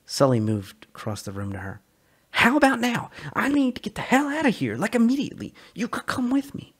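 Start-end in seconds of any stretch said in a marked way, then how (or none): 2.3-6.7 raised, urgent voice of a man desperate to escape; Sully's eager voice. Acted. Sully urging Calla to run away with him, excited and high. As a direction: push the plan on her, breathless with hope. Sully pleads to flee together.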